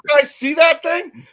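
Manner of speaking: high, loud retold cry of disbelief; startled exclamation. Retelling friends' shock at a sighting, startled exclamation. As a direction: blurt it out high and loud, urgently.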